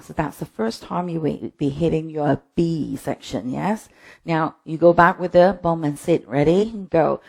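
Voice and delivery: normal talking voice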